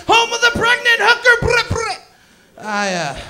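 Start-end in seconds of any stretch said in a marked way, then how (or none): 0.1-1.9 high hollered hometown hype with trilled noises; hyped-up shout. Pretend. Comedian hyping Hamilton to the crowd, hyped-up shout.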